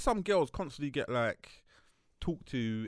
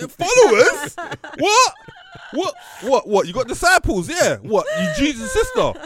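shrill disbelief, much higher and louder; mock outrage, cracking up high; incredulous; 14 semitones higher, 15 dB louder than usual. Real, not acted.

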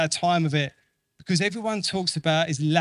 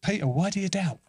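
Peter, why do you doubt? as a real question, pitched up; gentle question; 4 semitones higher, 3 dB quieter, faster than usual. Shown as a demonstration. Jesus asking Peter, gently questioning. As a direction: ask "doubt" as a genuine question. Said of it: "It was a question, not a statement".

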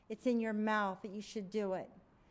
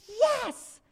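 excited yes; 12 semitones higher, 7 dB louder than usual. Retold or imitated. Lady says she'll be judge.

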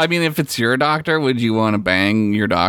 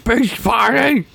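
Brack voice; 11 semitones higher, slower than usual. Retold or imitated. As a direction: mimic the silly voice back, up high. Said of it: "echoes Brack voice".

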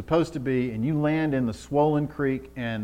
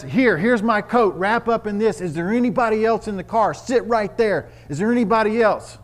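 higher, louder urgent commands; urgent rescuer; 9 semitones higher, 5 dB louder than usual. Acted. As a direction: take charge of the rescue. Rescuer speaking to trapped woman.

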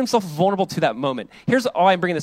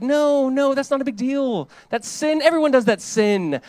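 high, dismissive flattery; flattering friend; 8 semitones higher, 3 dB louder, slower than usual. Pretend. Flatterer excusing a friend's sin, high and dismissive. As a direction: brush off the sin like it's nothing.

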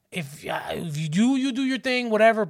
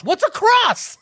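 loud, high puzzled question; mock child's bafflement; 13 semitones higher, 7 dB louder, slower than usual. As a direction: blurt it out like a clueless kid.

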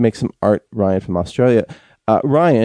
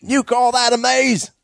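much higher, hammed-up Australian accent; 13 semitones higher than usual. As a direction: play the mock Australian bloke, way up high. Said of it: "Do it in an Australian accent".

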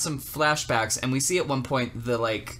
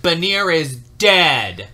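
stern superiors; 3 semitones higher, 9 dB louder, much slower than usual. Retold or imitated. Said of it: "his superiors were like".

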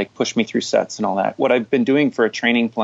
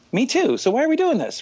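much higher, mock-incredulous quote; mock exasperated; 13 semitones higher than usual. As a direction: play it as a wry, high complaint. Mock reply to triathletes.

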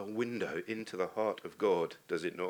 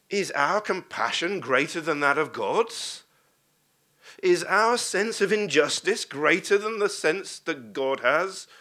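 higher and louder, stressing our; rousing preaching; 5 semitones higher, 6 dB louder than usual. Acted. Preacher challenging the congregation, louder and rising. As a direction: hammer home the challenge.

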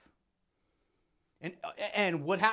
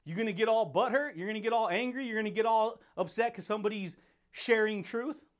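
ordinary delivery

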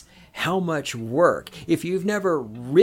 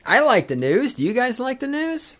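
high-pitched hammed-up hype-man question; exaggerated singsong catchphrase; catchphrase bit; 11 semitones higher, 3 dB louder than usual. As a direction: pitch it way up, loud and eager, rising at the end. Putting on a news catchphrase.

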